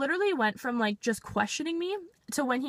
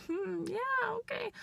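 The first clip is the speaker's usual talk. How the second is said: sarcastic squeak; 11 semitones higher, 7 dB quieter, slower than usual. Retold or imitated. Sarcastic reply to officer.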